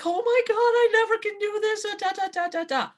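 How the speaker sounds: hammed-up fear behind the wheel; mock panic. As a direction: fake a frightened wail. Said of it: "And you get scared".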